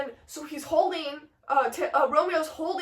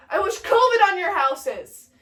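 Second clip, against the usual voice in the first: raised, stagey malediction; hammed-up dying proclamation; dying Mercutio's curse; 5 semitones higher, 5 dB louder than usual. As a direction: hurl a last curse at both families.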